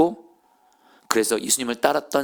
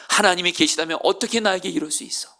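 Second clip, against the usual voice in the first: anguished outcry; 8 semitones higher than usual. Acted. Pastor crying out at God.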